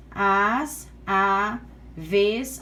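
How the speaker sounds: "as, a, vez" as isolated syllables with pauses; teacher sounding out "as, a, vez" piece by piece; separated syllables. Shown as a demonstration. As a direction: pick out "as, a, vez" slowly, one by one. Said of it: "Então, vamos lá separando".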